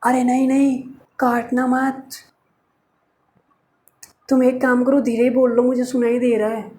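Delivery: lightly mocked, hushed pleading lover; pleading boyfriend. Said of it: "तो ये क्या बोलते हैं".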